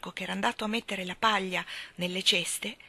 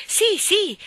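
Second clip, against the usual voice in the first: high, loud, excited yes; agitated confirmation; 12 semitones higher, 10 dB louder than usual. A narrator's reading. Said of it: "confermò agitandosi".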